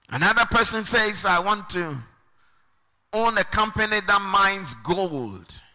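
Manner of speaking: high-pitched, forceful preacher delivery; impassioned preaching. Real, not acted. Preacher expounding a scripture to the congregation, impassioned. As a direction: preach it out, voice lifted well above talk.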